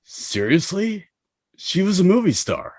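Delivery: appalled at their ignorance; incredulous. Acted. Shocked nobody knows Tara Reid.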